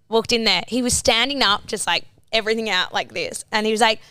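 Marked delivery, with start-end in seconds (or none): none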